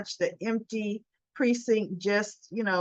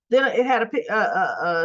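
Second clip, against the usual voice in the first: normal talking voice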